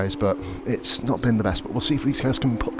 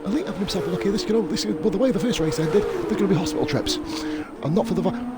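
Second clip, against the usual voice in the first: much higher, rising excitement over the overtakes; excited commentary; 13 semitones higher than usual. Real, not acted. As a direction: call the moves like you can't believe it. Overtakes in live race commentary.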